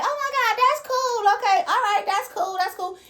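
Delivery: mock-gush like an impressed crowd; excited fan voice. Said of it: "A lot of people be like".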